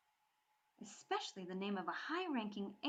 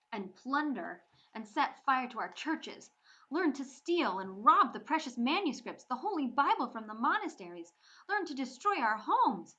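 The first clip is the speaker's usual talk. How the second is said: louder, higher, building fury; angry accusation; 5 semitones higher, 9 dB louder than usual. Acted. Bree accusing the Viking boy of burning churches, furious. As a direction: hurl the charges at him, rising.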